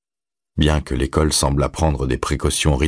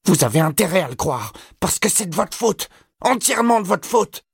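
put-on very high, agitated boy's voice; climbing higher with each accusation; high-pitched accusation. A narrator's reading. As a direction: hurl blame in a piercing high pitch. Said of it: "cria Todd d'une voix aiguë".

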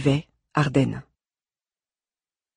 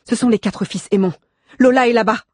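raised, louder, emphatic announcement; excited certainty; 8 semitones higher, 6 dB louder than usual. A narrator's reading. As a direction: declare the discovery with urgency. Nanès has found the location. (certitude excitée, voix haute et forte)

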